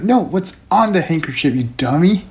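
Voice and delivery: exasperated Shauna. Acted. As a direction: roll your eyes at the dummy. Shauna scolding clueless Tobin.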